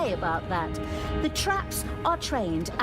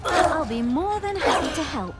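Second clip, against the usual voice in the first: villain's taunt; 7 semitones higher, 5 dB louder than usual. Acted. Villain taunting her father.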